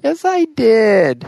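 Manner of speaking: high, slow, whiny insistence; childish whine. Acted. Childish bickering.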